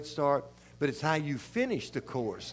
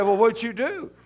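laughing boss; 7 semitones higher, 7 dB louder than usual. Retold or imitated.

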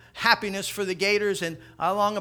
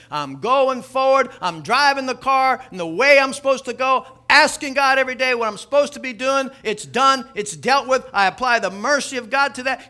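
loud declaration; 5 semitones higher, 8 dB louder than usual. Acted. Preacher booming Paul's vow of moving forward. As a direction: proclaim it with full force.

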